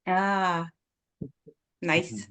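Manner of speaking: ordinary talk, nothing marked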